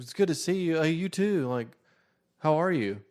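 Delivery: high-pitched mock pleasantries; put-on teen voice. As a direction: imitate two awkward teens swapping greetings. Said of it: "give them the voices that I heard".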